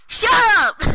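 sudden loud, high-pitched yell; real shout. Real, not acted. Kid snapping back at a teasing friend, shouting. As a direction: shout it to cut them off.

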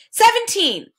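sudden high loud shout. A narrator's reading. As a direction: blurt the answer out triumphantly. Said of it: "shouted the humbug".

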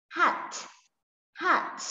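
"hat" with a crisply released final t; clear final consonant. Shown as a demonstration. Teacher models endings for pupil, "hat" with a clear final t. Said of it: "chú ý cái phần âm đuôi".

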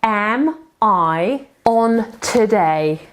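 slow, clearly spaced words; slow model phrase. Shown as a demonstration. Teacher modelling a question slowly for the class. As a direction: spell it out slowly for the learner. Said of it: "How long am I on today?".

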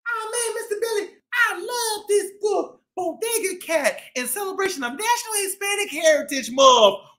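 squeaky puppet buddy gushing over the book. Acted. Puppet Busy answers host.

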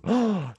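sharp high intake of breath; real startled gasp; shocked gasp. Real, not acted. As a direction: gasp in sudden realization. Realizing how old the clip is.

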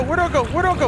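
normal talking voice

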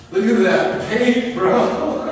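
high and loud, laughing; excited shout. Real, not acted. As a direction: call it out, amazed and laughing. Peeling paint revealed.

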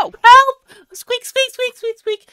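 high-pitched panicked squeaks; loud cries for help thinning into little squeaks; squeaking squirrel. Acted. Squirrel pleads while the goose attacks, squeaking.

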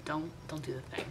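quiet muttered warning; hushed repeat. Shown as a demonstration. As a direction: drop it to a near-whisper, almost under the breath. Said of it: "yeah, don't".

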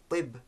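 "tib" with a heavy, hard-contact T; emphatic T. Shown as a demonstration. Teacher giving an example for learners, emphatic T in "tib". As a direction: raise the back of the tongue on the T of "tib". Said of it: "a very different sounds".

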